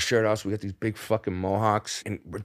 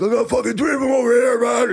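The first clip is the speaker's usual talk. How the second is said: much higher and louder shout, a bit fast; bouncer shout; 13 semitones higher, 8 dB louder than usual. Retold or imitated. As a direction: bark it out like a bouncer calling backup. Bouncer shouts over headset.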